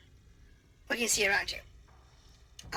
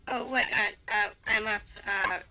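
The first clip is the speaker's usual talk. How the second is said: ordinary talk, nothing marked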